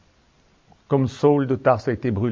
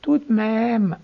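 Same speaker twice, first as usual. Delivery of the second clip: mock Swiss French accent, drawn out; playful imitation of a Swiss French objector; 6 semitones higher, slower than usual. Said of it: "je prends mon accent suisse".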